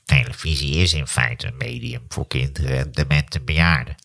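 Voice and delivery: low nasal drone, too nasal at end; grumpy professor, nasal; Maarten van Rossum impression. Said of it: "op het eind wordt hij te nasaal".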